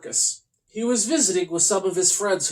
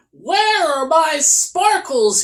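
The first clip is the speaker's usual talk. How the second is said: high, louder, slow wail; yelled at the sky; 10 semitones higher, 5 dB louder, much slower than usual. Acted. Sad unicorn yelling at the sky for his sparkles. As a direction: cry it up to the sky. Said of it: "he yelled at the sky".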